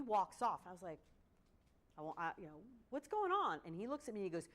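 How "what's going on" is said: high, loud calling out; concerned call. Retold or imitated. Coach asks athlete walking off.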